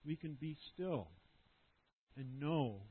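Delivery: soft, hushed lead-in to a moment of stillness; quiet reflective guidance. Acted. Pastor guiding the congregation into stillness before God, softly hushed. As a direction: ease the congregation into silence gently.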